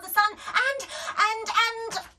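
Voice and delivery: high loud cry; mum breaking down. A narrator's reading. Mum crying over the bribes. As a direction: voice cracking into sobs. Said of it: "said Daisy".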